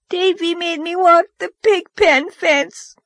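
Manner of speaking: put-on high, wailing child voice. A narrator's reading. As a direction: wail it out, near tears. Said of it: "wailed Dora".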